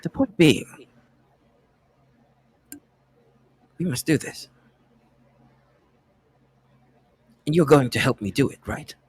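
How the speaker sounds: British character making a case; deliberate British character voice; British accent. Acted. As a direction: press the point in a British accent, with long pauses. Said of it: "my British accent makes the R hard".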